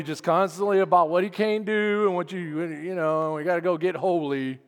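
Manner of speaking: low, glum mock complaint; killjoy. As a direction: grumble through it with no enthusiasm. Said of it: "like Killjoy".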